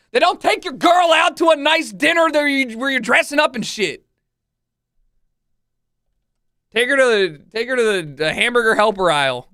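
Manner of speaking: loud rant. Real, not acted. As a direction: yell it out in exasperation. Ranting at viewers, shouting.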